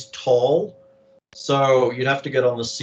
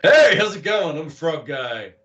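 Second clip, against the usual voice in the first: high, bright greeting then drop; cheerful frog voice; 4 semitones higher, 4 dB louder than usual. Acted. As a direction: chirp it like a friendly frog.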